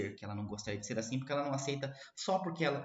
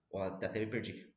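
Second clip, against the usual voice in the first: normal talking voice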